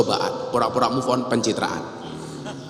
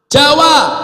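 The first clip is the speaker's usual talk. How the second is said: high, loud announcing call; called-out label; 11 semitones higher, 8 dB louder than usual. Shown as a demonstration. Calling out dialect labels in contrast. (seruan lantang bernada tinggi)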